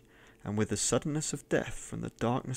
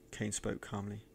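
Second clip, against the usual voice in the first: ordinary delivery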